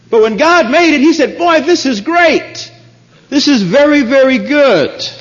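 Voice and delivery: fervent sermon climax; rising preaching. Acted. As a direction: build to a triumphant pulpit cry. Preaching at full force.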